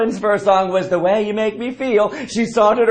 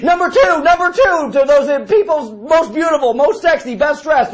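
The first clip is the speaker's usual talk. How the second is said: excited; 7 semitones higher, 3 dB louder than usual. Real, not acted. Excited listing.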